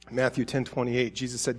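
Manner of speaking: ordinary delivery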